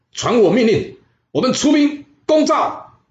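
very high, loud and slow proclamation; king bellowing the order to invade Zhao; royal command. Acted. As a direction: shout the order to march across the hall.

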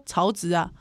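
ordinary delivery

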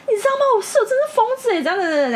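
high, frantic retelling of the call; replaying a panicked phone call to a friend. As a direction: blurt it out in a panic.

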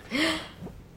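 sudden high gasp; sharp gasp. A narrator's reading. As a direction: gasp in suspense. Before explosion.

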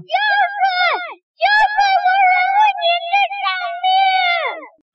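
crying. Acted. 蜘蛛被胶水粘住，哭喊求救. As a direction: cry out the words, sobbing. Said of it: "你干嘛哭得比蜘蛛小弟还要伤心啊".

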